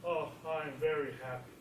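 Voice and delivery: low, soft, unhappy "happy"; low glum happy. Shown as a demonstration. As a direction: say you're happy but sound down. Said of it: "Or said".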